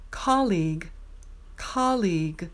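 colleague said KAH-leeg, clearly; careful colleague. Shown as a demonstration. Teacher modelling colleague for practice. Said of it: "Number fifteen".

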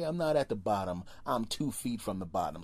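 ordinary talk

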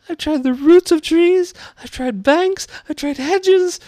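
very high, loud bird voice; put-on shrieking pigeon; exasperated pigeon. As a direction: rattle off grievances in a high screech. Pigeon character voice.

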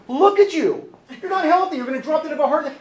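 hammed-up nagging partner voice; nagging spouse. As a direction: scold like a fussy spouse, rushing the end. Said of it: "you're like".

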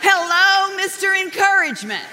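high, loud mock-offended hello to her husband; sarcastic retort. Wife answering her husband's teasing with a loud sarcastic hello. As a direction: throw the sarcasm back at him, loud and high. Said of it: "And I'm like".

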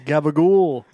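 "Gabagool" in a hammed-up Staten Island accent. Shown as a demonstration. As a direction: stretch it out New Jersey Italian style. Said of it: "How would you say Staten Island?".

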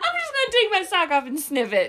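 laughing. Retold or imitated. Quoting husband again, laughing.

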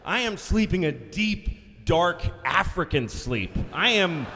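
slow, loud, drawn-out declaration; comic grandiose emphasis. Acted. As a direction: stretch each word with grand relish. Dramatizes how deeply he was sleeping.

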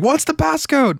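very high, panicky mock question; flustered skit homeowner. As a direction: squeak out the question as if fumbling at a safe. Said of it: "and he's like".

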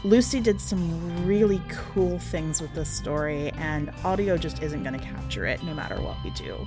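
usual speaking voice

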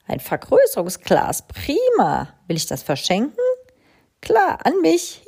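high delighted squeal; delighted crow. A narrator's reading. Crow rummaging her cupboard, delighted. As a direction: pounce on a treasure.

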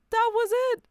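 high, loud outburst; emphatic exclamation. Real, not acted. Host telling of the man asleep on her lap, emphatic exclamation. Exclaims at climax of sad story.

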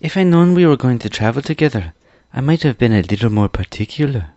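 a hint of an apologetic young maker; lightly voiced boy Tip. Story figure Tip speaks.

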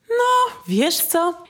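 drawn-out high "No, wiesz co?" like a doubtful coach; slow, hesitant, a touch of coach; coach's hesitant remark. Retold or imitated. Coach hesitating before giving the student feedback. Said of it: "trener mówi do ciebie".

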